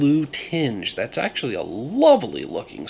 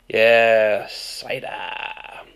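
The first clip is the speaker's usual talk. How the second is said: bad Maine accent; 4 dB louder, much slower than usual. Shown as a demonstration. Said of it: "I keep saying it with a really bad Maine accent".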